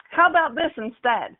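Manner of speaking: ordinary talk, nothing marked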